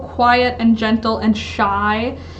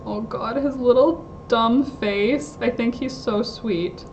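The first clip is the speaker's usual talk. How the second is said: ordinary delivery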